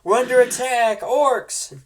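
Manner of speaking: guard crying out the attack; alarm call. Acted. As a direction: raise the alarm. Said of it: "It says".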